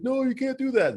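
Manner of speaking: high-pitched mock panic; freaking out, imitated. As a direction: protest in a squeaky pretend fluster. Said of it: "Owen Wilson's freaking out".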